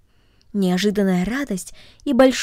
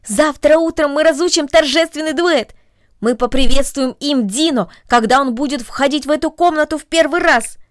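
put-on eager girl; 5 semitones higher, 6 dB louder than usual. A narrator's reading. Agnes planning a duet.

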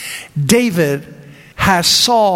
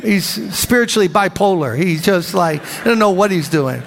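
normal talking voice

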